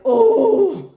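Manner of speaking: high, loud shocked oh; a high burst of shock; stunned oh. Retold or imitated. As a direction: react in disbelief. Stunned reaction to insult.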